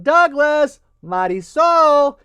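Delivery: high, loud call from the kitchen; mother calling the children from the kitchen. Acted. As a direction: call the kids to dinner across the house. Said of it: "when she yelled out".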